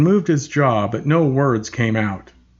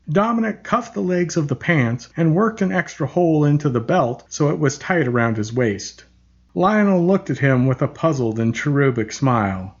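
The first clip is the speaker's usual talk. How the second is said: usual speaking voice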